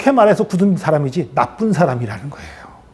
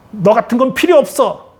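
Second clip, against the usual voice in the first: higher-pitched harsh dismissal; scolding rejection; 6 semitones higher than usual. Acted. Imagined harsh God rejecting a failed person, scolding. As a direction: cast them off with a rebuke. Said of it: "이렇게 야단치는 하나님".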